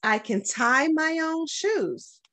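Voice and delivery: normal talking voice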